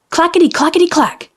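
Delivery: high bouncy clackety clack; clacking sound. A narrator's reading. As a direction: click it out high and quick. Goat's dropping sound.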